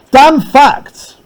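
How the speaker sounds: high, loud sarcastic jab. Shown as a demonstration. Teacher showing Lennox's sarcasm, high and loud. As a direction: spit it out with heavy sarcasm. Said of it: "Notice he says it like that".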